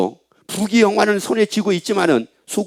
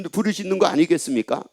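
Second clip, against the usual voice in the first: usual speaking voice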